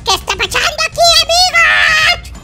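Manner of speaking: high panicked shouting, slow and stretched. Real, not acted. Kid vlogger shrieking at the zombie horde, in panic. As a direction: yell it out, overwhelmed by the mob. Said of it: "estoy gritando mucho".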